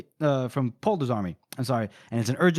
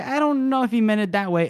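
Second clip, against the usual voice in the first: high-pitched mock protest; fighting back; 8 semitones higher, 4 dB louder than usual. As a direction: object in a higher voice, imitating a doubtful officer. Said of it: "like, fighting back".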